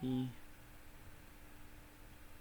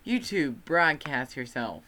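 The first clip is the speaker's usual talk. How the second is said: announcer voice; 2 semitones higher, 7 dB louder than usual. Pretend. Reciting slogan, announcer.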